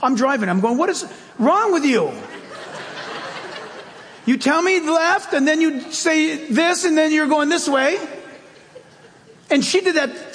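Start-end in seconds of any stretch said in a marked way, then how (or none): 0.8-8.0 high-pitched, indignant scolding of a machine; hammed-up frustrated driver; mock exasperation. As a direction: argue back in a raised pitch, playing baffled. Driver scolding his GPS, retold.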